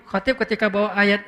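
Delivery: ordinary talk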